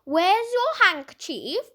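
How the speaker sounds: slow, stern parrot scolding. A narrator's reading. Parrot scolding Dinah after her sneeze, stern. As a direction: tick her off like a strict nanny. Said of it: "the parrot spoke to her sternly".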